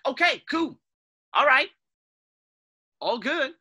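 high, loud, easy acceptance; brushing off bad news brightly; breezy okay. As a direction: shrug it off cheerfully. Imagined calm acceptance of loss.

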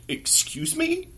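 slow, high-pitched affronted question; imitated icy offence, rising high; offended disbelief. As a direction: draw it out, high and incredulous. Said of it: "she just glared, like, dead in my eyes".

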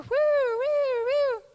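high, loud wailing siren whoops; siren imitation. Officer pulling the family over, wailing siren. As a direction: wail like a police car, swooping and falling.

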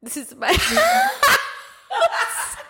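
very high, drawn-out laughing squeal; helpless laughter. Real, not acted. Embarrassed woman retelling wrong-house moment, high slow laughing squeal. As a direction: collapse into laughter midway through. Retold embarrassed confession, cracking up.

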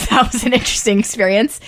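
laughing through the words; wry remark breaking into laughter; amused. Real, not acted. New mother recalling first bathroom trip, laughing.

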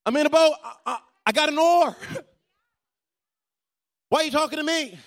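a put-upon boater, waving off a recruiter; a lightly voiced, indignant boat owner with a laugh; mock annoyed churchgoer. Pastor acts a churchgoer in another boat.

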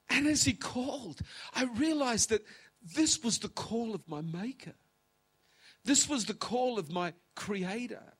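high, loud, emotional preaching; impassioned testimony. Real, not acted. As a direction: cry it out from an overflowing heart. Emotional testimony building to a peak.

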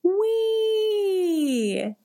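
long high roller-coaster whoop; gleeful wee. Retold or imitated. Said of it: "and I go".

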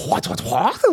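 high, stammered disbelief; acted bewilderment. Reacting to girlfriend's threat, high bewildered stammer. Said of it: "bewilder me".

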